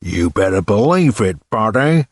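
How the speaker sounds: hammed-up film star impression; Bogart. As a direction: put on a bad movie tough-guy voice. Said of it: "in my best Bogart voice".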